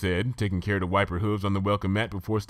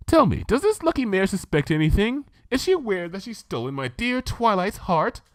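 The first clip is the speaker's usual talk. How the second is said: much higher, posh-sounding unicorn lady, chatty; Rarity, refined lady; 13 semitones higher, 3 dB louder than usual. Acted. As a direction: play the prim fashion pony gushing about tea. Rarity voiced by reader.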